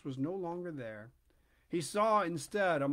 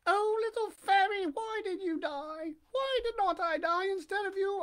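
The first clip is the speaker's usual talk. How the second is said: high-pitched puppet voice, pleading in grief; weeping Pinocchio; 10 semitones higher than usual. A narrator's reading. Puppet mourning at the fairy's tombstone, high plaintive lament. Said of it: "And as he wept, he said".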